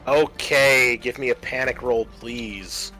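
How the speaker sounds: normal talking voice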